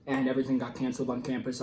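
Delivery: ordinary talk, nothing marked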